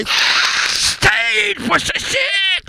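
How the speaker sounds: very high cracking shout; shrieking yell. Acted. Doorman shrieking at the visitor about secrecy, high shout. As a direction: scream it, outraged.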